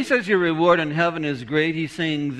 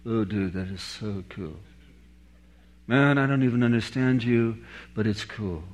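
low, understated dude talk; laid-back cool dude; 4 semitones lower, 3 dB quieter than usual. Acted. Reacting to super cool people who leave you guessing, laid-back dude. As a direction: drop it low and soft, coolly impressed.

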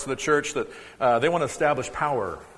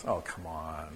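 playful disappointment; 11 semitones lower, 12 dB quieter, slower than usual. Real, not acted. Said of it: "We're never gonna learn if we say it like that".

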